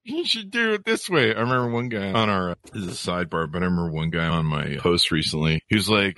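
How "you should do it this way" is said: high, put-on voice of a smug know-it-all; mocking know-it-all. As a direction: sneer it as a mock know-it-all, pitched up.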